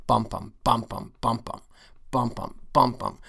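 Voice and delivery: bum, bum, strong then weak, in steady pairs; trochaic beat. Shown as a demonstration. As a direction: chant the falling rhythm. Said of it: "trochaic pentameter".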